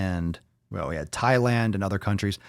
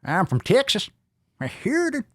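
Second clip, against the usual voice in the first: put-on Texas accent; 13 semitones higher than usual. Reading a Texan viewer's comment in a mock Texas accent. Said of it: "that accent".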